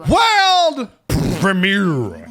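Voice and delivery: booming high announcer call. Shown as a demonstration. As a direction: hype it like a movie trailer. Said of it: "when a game is a world premiere".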